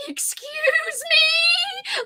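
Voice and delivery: high, very drawn-out mock indignation; mocking impression of me. Friend [name] mimicking her, hammed-up indignant excuse me. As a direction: overplay the affronted excuse me. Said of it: "she'll impersonate me saying".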